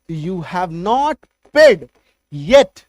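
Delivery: each word spaced out slowly for the class; slow dictation of an English sentence; slow model sentence. Shown as a demonstration. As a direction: say it slowly so students can follow. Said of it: "आपने अभी तक payment नहीं किया है".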